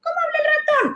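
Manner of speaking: tiny squeaky mouse voice, very high; high mouse voice for kids. Shown as a demonstration. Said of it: "cambios de código o cambios de entonación".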